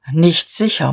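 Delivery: plain reading voice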